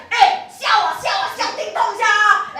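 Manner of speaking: loud, high barked foreign words; mock the keeper yelling at him; hammed-up keeper yelling; keeper's foreign shout. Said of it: "and he's like".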